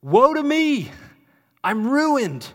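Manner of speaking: high, loud wail of despair; anguished cry. Acted. Isaiah crying out in despair before the holy God, loud anguished wail. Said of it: "what Isaiah cries out".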